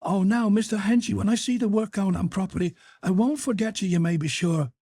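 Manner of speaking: high, wheedling mock voice; a lightly put-on whiny shopkeeper; mimicking the shoe boy. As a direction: parody the fob-off. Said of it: "but he said".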